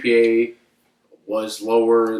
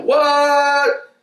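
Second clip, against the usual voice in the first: loud, very high, drawn-out disbelief; retold shocked yell; 5 dB louder than usual. Player reacting to coach's public insult, mock shocked yell. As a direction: stretch it into an outraged, hammed-up cry.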